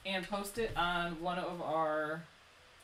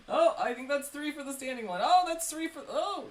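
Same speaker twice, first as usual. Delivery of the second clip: laughing excited; 7 semitones higher, 8 dB louder than usual. Real, not acted. Excited live vote count.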